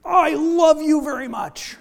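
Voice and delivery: high, loud mock shout of love; a loving line yelled much too loud; shouted loudly. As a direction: bellow it right into the dog's face. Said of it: "get right up into his face and shout loudly".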